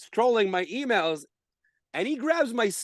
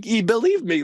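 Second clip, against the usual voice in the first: ordinary talk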